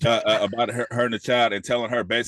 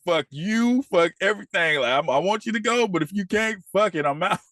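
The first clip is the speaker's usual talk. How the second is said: higher, hammed-up fed-up rant; fed-up quitter quote; 8 semitones higher than usual. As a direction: toss it all off, pitched up and defiant. Voicing Jerome quitting everything.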